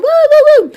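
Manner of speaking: high, loud "woo" whoops; playful woo noises. Retold or imitated. Aunt playing with a boy and the hose, playful high whoops. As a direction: whoop like you're spraying a hose.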